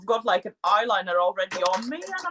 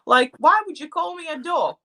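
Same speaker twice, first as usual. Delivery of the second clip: indignant confusion; 7 semitones higher than usual. Retold or imitated. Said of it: "I thought, like".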